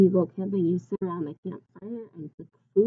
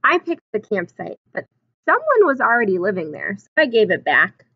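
storybook reading; 7 dB louder than usual. A narrator's reading. Reads picture book aloud.

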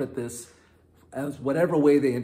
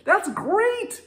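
disciples protesting; 14 semitones higher, 3 dB louder than usual. Retold or imitated. Disciples talking Jesus out of waking Lazarus, high and pleading. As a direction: beg Jesus not to go, voice up high. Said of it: "the disciples are like".

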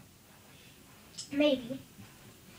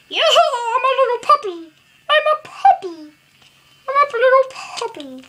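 high squeaky puppy voice, laughing; cartoon dog; 7 semitones higher, 6 dB louder than usual. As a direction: play a giddy little puppy. Voicing the toy puppy.

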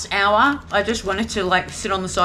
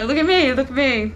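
mocking show-off; 5 semitones higher than usual.

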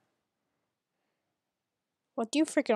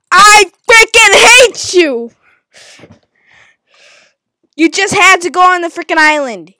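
screamed rage, much higher; furious scream; 8 semitones higher, 15 dB louder than usual. Real, not acted. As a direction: scream it at him. Raging at an opponent.

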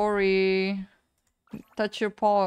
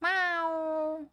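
cat meow; 7 semitones higher than usual. Pretend. Meowing at cat in game.

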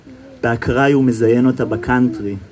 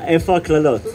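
higher, asking in mock outrage; indignant question; 4 semitones higher, faster than usual. A reader mock-demands the curses from the songs, raised. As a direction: demand to know where the curses went.

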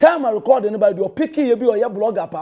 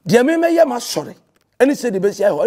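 usual speaking voice

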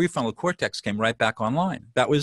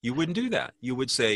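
ordinary talk

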